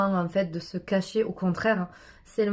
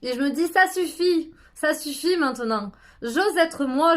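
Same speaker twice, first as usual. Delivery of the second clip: higher and louder declarations; shouting; 7 semitones higher, 5 dB louder than usual. Shown as a demonstration. Guide shouting her connection to the moon, loud. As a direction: cry it out to the moon. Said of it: "pour pouvoir crier".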